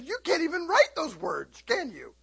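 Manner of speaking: put-on a touch of a surprised girl's voice; mock amazed coworker. Coworker teasing the daughter about the words. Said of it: "she says".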